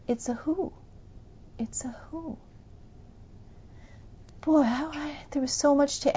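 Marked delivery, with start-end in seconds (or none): 1.6-2.4 breathy whispered repeat. Real, not acted. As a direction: hush it to a whisper, letting it sink in.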